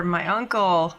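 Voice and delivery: ordinary delivery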